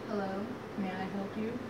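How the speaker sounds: soft, low, cool greeting; unbothered pig. Acted. Pig answering the wolf's knock, softly unbothered.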